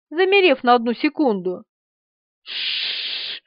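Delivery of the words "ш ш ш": long soft "shhh" hiss; demonstrated hiss. A narrator's reading. Man demonstrates how the cockroach hisses, hissing. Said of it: "продемонстрировал Бунимович".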